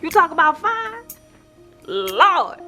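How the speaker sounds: high-pitched, swooning exclamation; thrilled, playful gushing; gushing admiration. Acted. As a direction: exclaim like you can't believe how gorgeous he is. Gushes over a handsome man.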